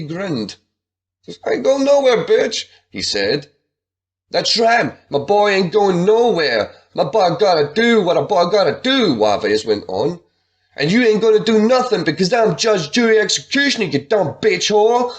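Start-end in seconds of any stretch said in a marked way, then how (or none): 1.5-2.7 high, loud swaggering bro; cocky bro voice. Acted. Buddy the bro sneering back at Kim, high and loud. 4.3-9.1 swaggering thug. Acted. Juarez backs up his boy. 10.8-15.1 high, loud swaggering yell; yelled threat. Acted. Juarez yelling threats at Kim, high and loud. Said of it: "he yelled".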